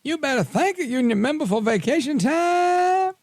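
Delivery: high, exaggerated Southern accent, mocking; mock Southern drawl. Radio host mocking a politician's new Southern accent, high mock Southern drawl. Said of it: "Southern drawl".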